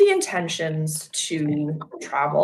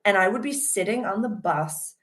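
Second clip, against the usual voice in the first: ordinary delivery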